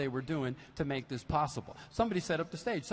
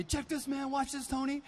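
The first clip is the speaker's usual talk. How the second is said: put-on eager young drummer; 8 semitones higher than usual.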